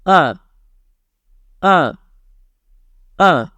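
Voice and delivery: "Uh." dropping sharply from high; falling fourth tone. Shown as a demonstration. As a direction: snap "Uh." down from the top. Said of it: "The fourth tone is like this".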